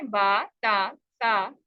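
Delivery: बा, ता, सा each drawn to open "aa"; correct Arabic reading. Shown as a demonstration. Teacher repeating for the class, long "aa" endings. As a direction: separate letters, each ending "aa". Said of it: "आ वाली sound के साथ पढ़ना".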